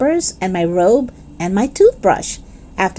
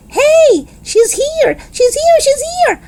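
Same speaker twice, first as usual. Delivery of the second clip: thrilled yell; slower than usual. Acted. Girl yells to her parents that the ride came, high thrilled yell. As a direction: shriek with delight that she's here. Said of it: "I yelled, real thrilled".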